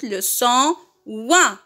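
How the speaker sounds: slow, drawn out for repeating; teacher's slow repeat-after-me delivery; slow model pronunciation. Shown as a demonstration. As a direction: model it slowly, stretching "ouin" with a falling end. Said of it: "Répétez".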